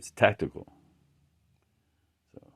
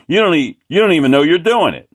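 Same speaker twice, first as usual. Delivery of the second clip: higher, louder and fast, "don't" hammered; insulting shout; 8 semitones higher, 9 dB louder, faster than usual. Retold or imitated. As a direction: throw the insult right back at him, fast. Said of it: "He was insulting me".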